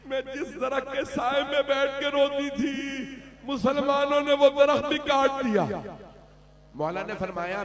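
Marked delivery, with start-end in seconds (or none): 0.1-5.6 rising, loud tearful lament; weeping complaint. Acted. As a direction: let the voice break into a wail. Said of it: "इतना क्यों गिरिया कर रही हैं".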